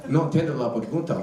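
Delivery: mock Mexican Spanish accent, pitched low; hammed-up Mexican Spanish, feigning no English; full Mexican. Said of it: "I went full Mexican".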